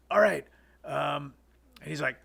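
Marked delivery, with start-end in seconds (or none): 0.1-0.5 abrupt loud, high outburst; imitated yeller. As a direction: blurt it like a shouty director. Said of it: "this guy yells a lot".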